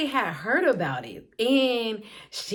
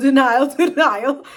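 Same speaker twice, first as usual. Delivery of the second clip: loud laughing repeat of denial; 3 semitones higher, 9 dB louder than usual. Real, not acted. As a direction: laugh it out, teasing herself. Mocking her own denial.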